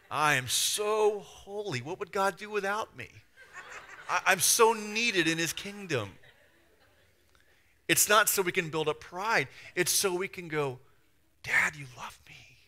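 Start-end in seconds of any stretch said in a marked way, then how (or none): 0.1-6.2 slow, high, smug and laughing; drawn-out self-important brag with a laugh; mock holier-than-thou. Mocking a proud churchgoer. 11.4-12.6 hushed, intimate whisper; whispered prayer. Pretend.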